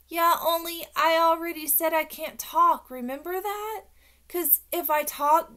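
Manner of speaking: high, loud exasperated protest; huffy child. A narrator's reading. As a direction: huff it at someone not listening. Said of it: "a huffy breath at him".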